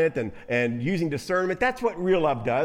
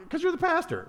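wife's punchline; 12 semitones higher than usual. Retold or imitated. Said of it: "His wife says".

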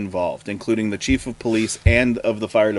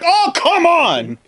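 high, slow groan of frustration; frustrated Carl; 5 dB louder, much slower than usual. Acted. German Carl missing the aliens again, high slow complaint. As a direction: stretch out your disbelief, high and slow.

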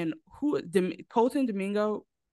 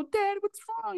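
put-on much higher child voice; imitating little boys. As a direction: voice a concerned little kid, high-pitched. Voices little boys asking their dad.

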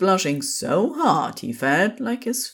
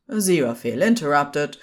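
ordinary delivery

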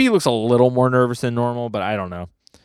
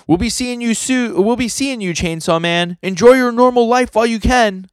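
high, raised call after someone; taunting Barum; 13 semitones higher, 4 dB louder than usual. Acted. Barum calls after Denji as he runs away, high and taunting. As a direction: send a parting taunt in a pitched-up voice.

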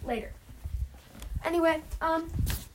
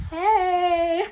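higher, put-on doorway greeting; bodyguard; 5 semitones higher, 4 dB louder than usual. Derek standing guard in the doorway, bodyguard voice. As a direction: play a bodyguard's hey. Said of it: "Sorta like bodyguards".

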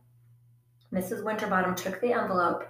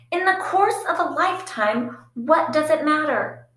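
much higher, louder exasperated outburst; exasperated sister shouting the motto; 8 semitones higher, 6 dB louder than usual. Acted. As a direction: fling the motto at her sister. Phoebe snapping at Prudence.

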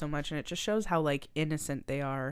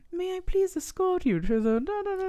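much higher, prim and polite; posh steward; 13 semitones higher than usual. Retold or imitated. Steward escorting the rich to the deck, prim posh voice. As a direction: put on a courteous servant voice. Polite crew to first class.

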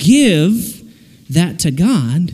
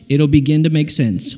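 usual speaking voice

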